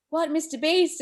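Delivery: high surprised What, Mister Beast; stunned disbelief. Retold or imitated. Brother surprised at name.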